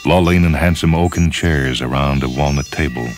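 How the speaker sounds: plain reading voice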